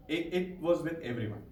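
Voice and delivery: ordinary delivery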